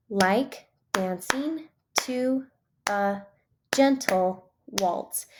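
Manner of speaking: very slow "like dancing to a gentle waltz", beat by beat; clapped-out syllables. Shown as a demonstration. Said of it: "I like to clap 'em out".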